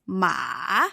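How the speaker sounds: "Ma" dipping down then up; third tone. Shown as a demonstration. Teacher showing the third tone, "Ma" falling then rising. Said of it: "third tone is going down and up".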